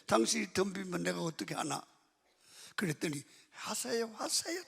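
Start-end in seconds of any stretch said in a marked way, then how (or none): 3.5-4.6 high, climbing mock-wife voice; imitated wife giving in. Wife telling husband to go ahead and yell, imitated high voice. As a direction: mimic her reply in a high pitch.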